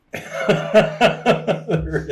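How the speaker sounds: high, loud burst of real laughter. Real, not acted. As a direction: crack up out loud. Co-host cracks up at the game.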